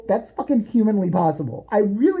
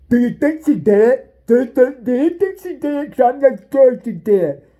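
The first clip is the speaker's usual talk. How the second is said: mocking interviewee; 4 semitones higher, 7 dB louder than usual. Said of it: "parody of interviewee".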